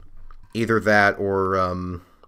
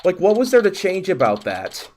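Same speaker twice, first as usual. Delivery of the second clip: incredulous; 8 semitones higher, 4 dB louder than usual. Real, not acted. Amused disbelief at typo.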